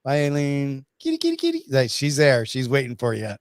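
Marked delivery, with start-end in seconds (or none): 1.0-1.6 very high sing-song kitty call; calling a cat. Real, not acted. Calling the guest's cat at the end of the show, a very high coaxing call. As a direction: coax the cat to come over.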